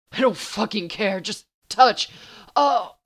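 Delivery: loud, high, urgent demand; impatient pleading. Acted. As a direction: burst out desperately, rising to a cry. Desperate Dean begging impatiently.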